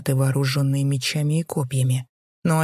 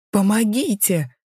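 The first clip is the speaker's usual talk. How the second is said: high, slow cry for help through tears; a frightened maid begging; tearful plea; 6 semitones higher, 3 dB louder, slower than usual. A narrator's reading. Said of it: "со слезами на глазах произнесла девушка". (сквозь слёзы, высоко)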